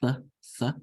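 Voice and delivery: th with tongue between teeth, breathy; isolated th sound. Shown as a demonstration. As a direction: show the old T H sound. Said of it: "pronounced with a T H sound".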